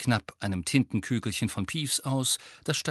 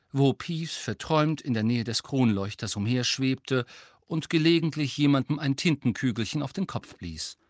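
plain reading voice

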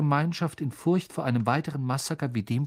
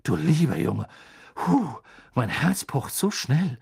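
shaken; 4 dB louder than usual. Acted. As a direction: catch your breath after a fright.

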